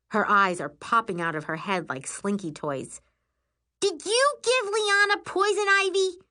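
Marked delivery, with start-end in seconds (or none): 3.8-6.2 very high, shrieked question; furious accusation. A narrator's reading. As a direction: confront your sister in outrage. Sister furiously interrogates narrator.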